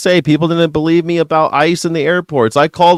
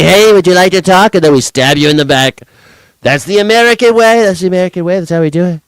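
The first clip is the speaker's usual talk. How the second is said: mock Trump; 3 semitones higher, 4 dB louder than usual. Voicing American leaders mockingly.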